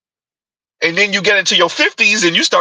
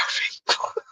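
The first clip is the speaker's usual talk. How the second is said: whispered giggle; 12 dB quieter than usual. Real, not acted. Laugh after mocking whisper.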